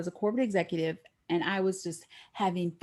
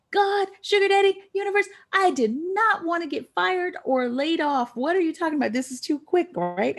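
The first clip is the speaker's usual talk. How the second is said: high, loud frantic plea; panicked plea; 9 semitones higher, 4 dB louder than usual. Retold or imitated. Fearing she is fired, high panicked plea. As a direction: beg the universe in a panic.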